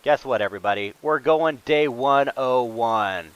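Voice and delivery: usual speaking voice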